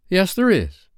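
put-on high, emphatic boyish cry; eager cry. A narrator's reading. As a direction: cry it out with sudden certainty. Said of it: "cried Peter".

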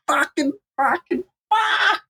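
high, drawn-out mock cursing; swearing like a sailor. Storyteller cursing the leaking boat, high frantic swearing. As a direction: build to a squealed final curse. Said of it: "swearing like a sailor, just like".

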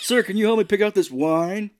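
put-on lady voice. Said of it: "She's like".

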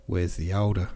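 ordinary delivery, nothing marked

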